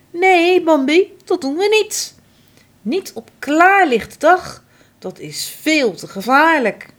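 put-on high, loud firm no to a child; firm mother. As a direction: stop him firmly, worried. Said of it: "schudt heftig haar hoofd".

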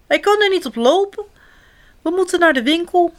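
put-on high, fast, urgent child's voice; storybook little sister fretting; panicky. A narrator's reading. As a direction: rush it out in mounting alarm. Sister panics about the broken leg.

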